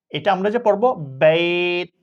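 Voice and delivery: ordinary talk, nothing marked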